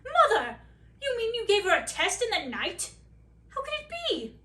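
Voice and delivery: loud, high disbelieving cry; shocked son shouting up high; astonished prince. Acted. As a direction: prince stunned by his mother's secret test, loud and high.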